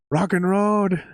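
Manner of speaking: a mild mock yell of "Rock and Road"; mock accented yell. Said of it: "used to yell".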